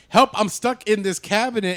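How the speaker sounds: retold cry for rescue; acted call for help. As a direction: call out as if trapped. Acting trapped character calling for help.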